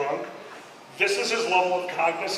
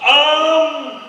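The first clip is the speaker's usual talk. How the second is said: high, loud official radio voice; lightly voiced control tower; tower operator voice; 6 semitones higher, 5 dB louder, slower than usual. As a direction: announce yourself as the tower. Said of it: "this is the tower".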